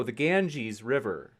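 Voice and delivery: normal talking voice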